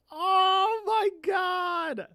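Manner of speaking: high, tearful, stretched-out cry of delight; overwhelmed wail. Real, not acted. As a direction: wail it slowly, overcome by beauty. Gushing over the opening's animation.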